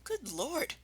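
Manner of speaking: a startled gentle badger; Badger's voice. Badger surprised to find Rat here. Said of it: "said Badger".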